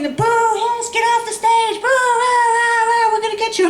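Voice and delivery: high, sing-song imitation of an angry crowd booing; chanting boos like a cartoon mob; mock crowd jeering. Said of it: "and they're shouting".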